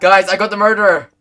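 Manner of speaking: loud, high urgent announcement; urgent call. Real, not acted. Player telling the group of the capture, urgent shout.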